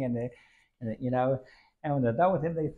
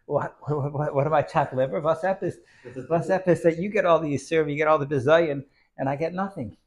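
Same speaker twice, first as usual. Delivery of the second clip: jealous complaint; 4 semitones higher, 6 dB louder than usual. Acted. Brother complains, jealous outburst.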